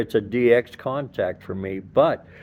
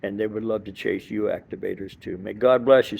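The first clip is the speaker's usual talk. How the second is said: ordinary talk, nothing marked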